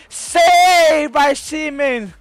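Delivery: slow, high, loud drawn-out cry; triumphant call. Real, not acted. Gamer celebrating his keeper's save, slow triumphant call.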